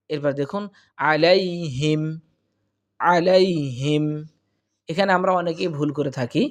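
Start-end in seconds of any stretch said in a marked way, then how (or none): 1.1-4.0 আলাইহিম drawn out slowly; slow recitation. Shown as a demonstration. Teacher reciting আলাইহিম for students.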